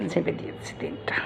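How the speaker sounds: whispered confession; sneaky whisper. Real, not acted. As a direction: whisper it like a secret theft.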